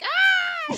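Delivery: very high, loud mock wail; imitated tantrum bawl; spiteful fake crying. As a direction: shriek like a kid crying on purpose. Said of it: "like, spiteful crying".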